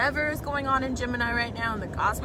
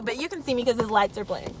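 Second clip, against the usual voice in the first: normal talking voice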